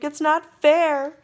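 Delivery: high, loud, slow wail; whining tantrum. Acted. Duke wailing at the king, slow loud tantrum. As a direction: wail it like a spoiled child. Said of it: "cried the Grand Duke".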